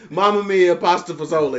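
hammed-up Italian fan; mock Italian crowd mutter; Italian accent.

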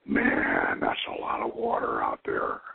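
whispered stoned hippie voice. Retold or imitated. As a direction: breathe it out like a dazed stoner. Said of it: "they're both stoned".

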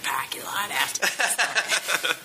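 "parking lot" with dropped r, laughing whisper; whispered Boston tough-guy threat; mock Boston accent. Teasing a friend, Boston accent whisper. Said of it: "I'm digging the Boston thing".

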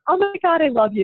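gushing thanks. Retold or imitated. Client thanking her professional organizer, gushing. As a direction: gush as if hugely relieved.

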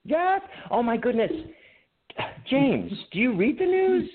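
high-pitched incredulous reply; exasperated disbelief. Real, not acted. Podcaster to co-host who missed the news, high exasperated disbelief. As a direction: scold a friend in high, mock-appalled amazement. Exasperated at co-host's outdated question.

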